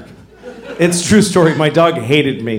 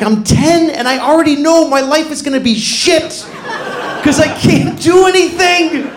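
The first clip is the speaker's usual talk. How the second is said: put-on whiny kid; 10 semitones higher than usual. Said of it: "I was like".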